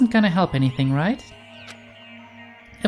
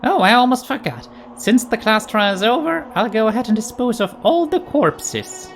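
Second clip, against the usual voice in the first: higher, sing-song mascot voice; Monokuma voice; 5 semitones higher than usual. Acted. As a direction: play a gleeful cartoon bear. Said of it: "Monokuma told us".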